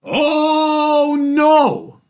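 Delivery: loud, high, drawn-out wail of refusal; horrified father. A narrator's reading. Father sees the cart of ice cream, horrified cry. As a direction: wail it in dismay.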